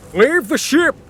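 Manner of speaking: a high, loud shout to abandon ship; shouted order. Acted. Captain ordering everyone off the sinking ship, shouting. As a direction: bellow it over the storm. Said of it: "the captain shouted to everyone".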